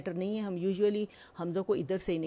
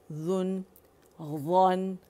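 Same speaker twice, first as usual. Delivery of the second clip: ordinary talk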